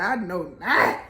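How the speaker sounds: fired-up indignation; indignant. Retold or imitated. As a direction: sass it, ready to fight. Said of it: "she be ready to fight".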